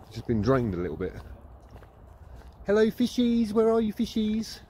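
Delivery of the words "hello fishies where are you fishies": high, slow coaxing call to fish. Real, not acted. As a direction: coo at the fishies like pets. Calling to fish in pond.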